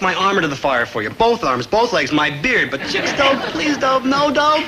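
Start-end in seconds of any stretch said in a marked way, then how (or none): 2.7-4.6 high, rising, desperate begging; panicked pleading. Acted. Maynard begs Dope not to make him date, panicked pleading. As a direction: beg Dope to spare you, climbing higher each time.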